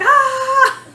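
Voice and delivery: high loud panicked shriek with a laugh; panicked scream. Retold or imitated. Wife sees husband come out with the gun, panicked laughing shriek. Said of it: "I'm like".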